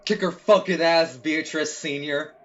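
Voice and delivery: loud cheering, slow on the name; cheering shout. Real, not acted. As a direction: yell encouragement at the screen. Cheers on the duel.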